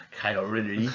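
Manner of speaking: put-on a playful, lightly done Japanese accent on the name; jokey Japanese accent announcing the name; mock Japanese accent. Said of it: "I did my Japanese accent right there".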